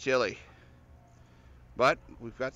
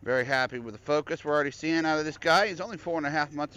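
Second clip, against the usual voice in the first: louder, higher over street noise; yelling over traffic; 5 semitones higher, 6 dB louder than usual. Real, not acted.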